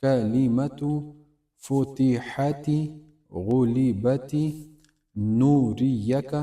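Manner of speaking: each word stretched out very slowly; slow recitation. Shown as a demonstration. Teacher models the reading slowly for students, drawn-out recitation. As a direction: draw every word out, slow, for learners to copy. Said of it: "teacher demonstrates slow word reading".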